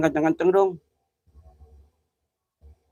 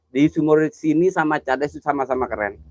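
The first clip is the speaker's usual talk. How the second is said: ordinary talk, nothing marked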